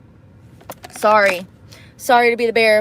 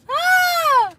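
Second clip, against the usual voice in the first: high delighted squeal. Real, not acted. Laughing outburst.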